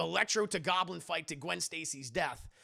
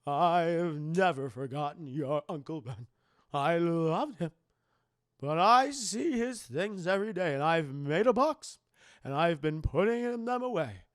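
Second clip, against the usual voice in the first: Aunt May voice; 8 dB louder than usual. Retold or imitated. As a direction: lecture him like a no-nonsense aunt. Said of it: "Aunt May speech".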